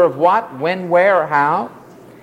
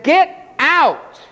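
high-pitched, emphatic dismissal; acted command; 10 semitones higher than usual. Preacher voicing Jesus to the mourners, commanding. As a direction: order the crowd out, pitch leaping up.

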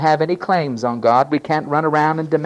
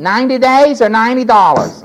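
loud, high pronouncement; stern sentencing; 9 semitones higher, 9 dB louder than usual. Acted. Judge sentencing a demanding defendant, loud and stern. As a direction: rap out the penalty like a judge.

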